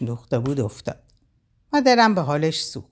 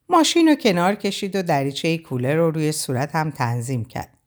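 ordinary delivery

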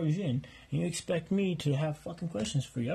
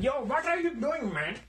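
lightly voiced annoyed roommate, a bit higher; nosy roommate needling him, slightly higher pitch; intruding roommate; 8 semitones higher than usual. Roommate returns, laughing.

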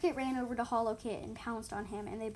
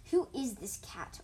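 slow, deliberate hostile question; understated hostile hiss; violent hiss; 4 dB louder, slower than usual. A narrator's reading. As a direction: demand it with menace. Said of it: "hissed violently".

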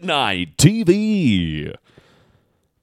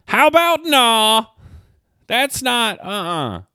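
mocking dismissal; 9 semitones higher, slower than usual. Real, not acted.

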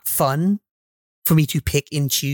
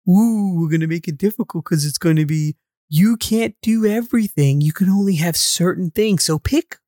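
higher, hammed-up smug taunt; mocking designer voice; 5 semitones higher than usual. Mocking game designers' logic.

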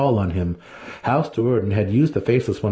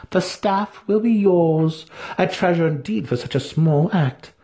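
enticing promise; 10 semitones higher than usual. A narrator's reading. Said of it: "Vienna promised".

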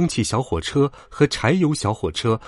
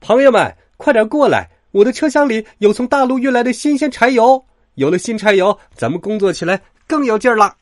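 high, excited shout to friends; high-pitched hawker-like announcement; loud calling out; 10 semitones higher, 5 dB louder than usual. A narrator's reading. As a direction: summon everyone with great news. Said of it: "他高声叫道".

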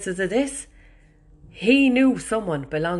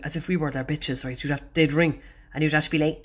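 ordinary delivery

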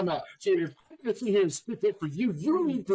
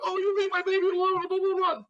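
put-on high, fussy mom defending her baby; mock a mother shielding her son; high mock mom voice, protective; 11 semitones higher than usual. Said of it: "they're like".

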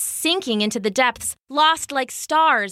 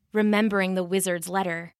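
ordinary delivery

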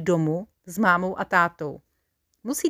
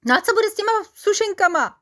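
a much higher, fast, whiny plea; sobbing question; 12 semitones higher, 5 dB louder, faster than usual. A narrator's reading. Adjutant whines about the biscuits, mock sobbing. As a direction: whimper about the lost biscuits. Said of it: "zavzlikal první pobočník".

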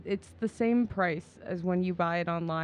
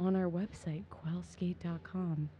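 slow, softer host plug voice; podcast voice; 6 dB quieter, slower than usual. Real, not acted. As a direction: read the web address like a radio host. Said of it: "She used her podcast voice".

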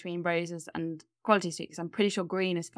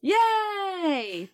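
excited cheer; 12 semitones higher, 4 dB louder than usual. Real, not acted. Correct guess cheered.

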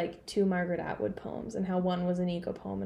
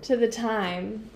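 scholarly voice; slower than usual. Retold or imitated. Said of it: "that's my scholarly voice".

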